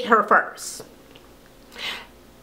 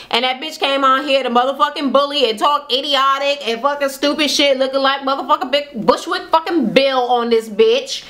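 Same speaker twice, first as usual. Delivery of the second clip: ordinary delivery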